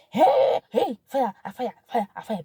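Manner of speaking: high, loud "hey" easing as it fires on; heated outburst. Acted. Wife acting out an argument with husband, heated outburst. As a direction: fire off like an argument kicking off.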